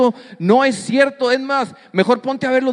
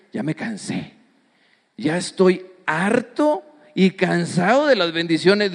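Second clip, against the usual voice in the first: low, hushed start, feigning being fed up; mock weariness; 3 semitones lower than usual. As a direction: play a believer worn out by blessings. Acted believer fed up with blessings. (hartazgo fingido, cansado)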